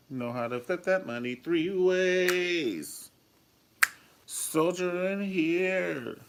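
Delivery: high, slow hype call; high drawn-out praise; hype shout. Real, not acted. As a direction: call it out like a cheer. Hyping up schemer.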